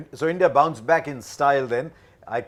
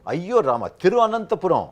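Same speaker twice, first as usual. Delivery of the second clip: playful South Indian exclamation, pitched up; south indian accent; 7 semitones higher, 7 dB louder than usual. Pretend. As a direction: ham up the full Malayali name, louder. Joking over the city's long name.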